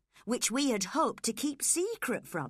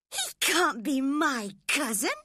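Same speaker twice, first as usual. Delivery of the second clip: scornful; 6 semitones higher, 5 dB louder than usual. Acted. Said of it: "cried Cathy, with a scornful laugh".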